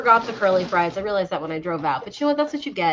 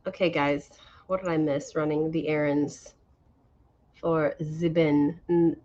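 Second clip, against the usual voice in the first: ordinary talk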